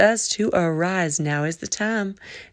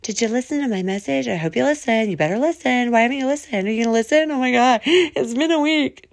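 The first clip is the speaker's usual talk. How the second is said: pushy friend; 5 semitones higher than usual. Retold or imitated. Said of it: "I ain't reaching out going".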